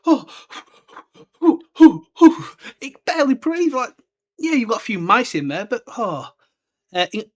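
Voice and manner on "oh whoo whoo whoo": high, loud hooting owl; owl hoots. Retold or imitated. Owl stuck in a coat, loud high hoots. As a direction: hoot like a squashed owl. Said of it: "It's probably like".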